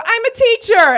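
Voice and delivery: loud, high-pitched blurt; over-eager announcement. Retold or imitated. Contestant telling interviewers her job, over-eager shout. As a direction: proclaim it far too keenly.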